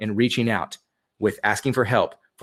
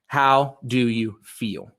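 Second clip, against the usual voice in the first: very slow, each word spaced out; said slowly; much slower than usual. Shown as a demonstration. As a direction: drag it out so people can write it down. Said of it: "I'm gonna go slow".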